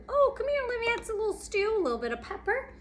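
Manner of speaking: high, fast little mouse voice; squeaky mouse voice. Acted. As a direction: chirp it like a busy mouse. Mouse seasons the stew.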